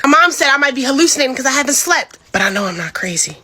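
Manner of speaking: plain reading voice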